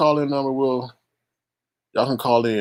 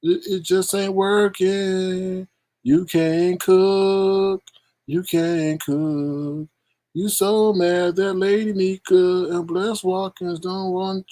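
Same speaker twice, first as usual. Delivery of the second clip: higher, slow drawn-out taunt; mocking singsong; 7 semitones higher, slower than usual. Host imitating a woman taunting a caller, slow higher taunt. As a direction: sing the insults out slowly. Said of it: "She said".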